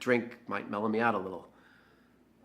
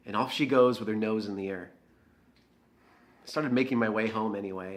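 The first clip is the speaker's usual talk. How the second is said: usual speaking voice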